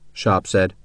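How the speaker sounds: ordinary delivery, nothing marked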